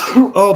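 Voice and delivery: sudden loud sneeze cough; sneeze-cough burst. Real, not acted. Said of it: "I can feel it coming up".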